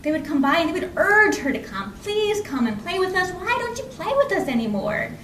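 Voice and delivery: usual speaking voice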